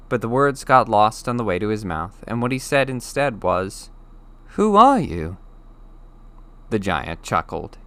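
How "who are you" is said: put-on high, louder, small boy's voice; startled boy. Harry asks the giant who he is, startled. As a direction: blurt it out instead of thanks.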